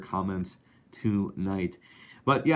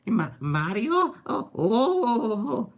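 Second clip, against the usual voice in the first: high, nervous whimpering Luigi calling for his brother; timid cartoon plumber lost in a haunted house; scared Luigi impression; 13 semitones higher, 4 dB louder, slower than usual. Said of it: "That's my Luigi impression from Luigi's Mansion".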